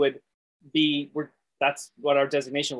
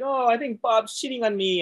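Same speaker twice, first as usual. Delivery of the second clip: higher-pitched imitation of weepy wife; mock tearful; 7 semitones higher than usual. Helen crying to E at her house, mock tearful. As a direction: lightly act out a crying complaint. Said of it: "Helen is crying and she's like".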